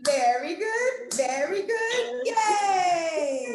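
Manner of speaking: slow, climbing high to yay; rising cheer. Shown as a demonstration. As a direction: build to a joyful yay. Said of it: "cheer chant again".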